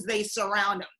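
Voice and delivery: ordinary delivery, nothing marked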